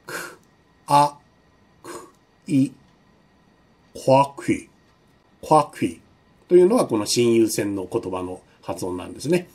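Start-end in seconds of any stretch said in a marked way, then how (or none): 0.0-6.0 く held in the u-shaped mouth before あ and い; こわくい spoken from a rounded く mouth; consonant-first syllables. Shown as a demonstration. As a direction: keep the く lip shape, then slide into the vowel. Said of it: "くの口のままで".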